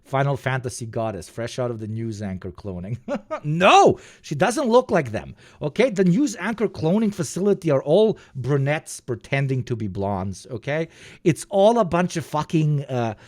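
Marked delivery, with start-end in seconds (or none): 3.4-8.2 high-pitched exclaimed objection; indignant outburst. Real, not acted. Streamer objects that she is unlike other anchors, indignant outburst. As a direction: burst out high on "No".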